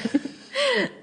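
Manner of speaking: a high, amused laugh; high burst of laughter. Real, not acted. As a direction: laugh out at your own joke. Laughs at own mock welcome.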